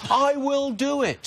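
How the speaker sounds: high, indignant outburst; yelling. Real, not acted. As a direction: let the annoyance burst out high. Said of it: "That's how [name] yells".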